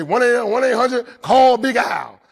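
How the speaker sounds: sing-song the commercial; ad jingle. Retold or imitated. Reciting the ad jingle.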